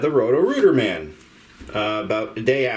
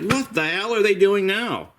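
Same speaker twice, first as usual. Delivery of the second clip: exasperated inner voice; 10 semitones higher than usual. Retold or imitated. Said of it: "It's like".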